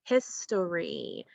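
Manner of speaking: "history" joined up as one slow word; slow whole-word "history", falling at the end; blended word. Shown as a demonstration. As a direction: blend the syllables of "history" smoothly together. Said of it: "So we get the word history".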